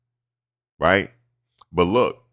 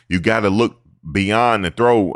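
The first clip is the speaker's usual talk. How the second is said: usual speaking voice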